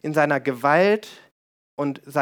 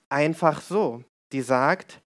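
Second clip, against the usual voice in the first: normal talking voice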